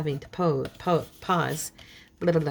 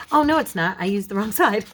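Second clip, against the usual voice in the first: higher, louder relieved laughing; 4 semitones higher, 6 dB louder than usual. Real, not acted.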